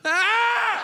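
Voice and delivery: sudden high yelp of horror; mock panicked shout. Teen hearing why dad wants him gone, mock horror yelp. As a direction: scream it, ironic after calmly. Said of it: "I reacted calmly".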